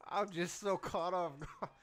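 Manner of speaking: talking through real laughter, slowed; words breaking up with giggles; laughing. Real, not acted. Streamer cracking up at a clip.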